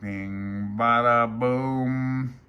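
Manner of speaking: comic rimshot; bada boom punchline. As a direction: pop it like a drum hit.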